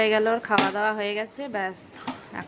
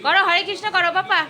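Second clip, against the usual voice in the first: much higher, louder sing-song urging; 9 semitones higher, 11 dB louder than usual. Real, not acted. Urging baby to pray. (উঁচু গলায় আদুরে তাগাদা)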